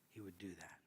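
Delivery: hushed storyteller at the pulpit; whispered narration. A narrator's reading. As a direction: whisper it softly.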